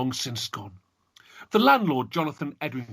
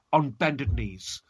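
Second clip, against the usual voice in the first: usual speaking voice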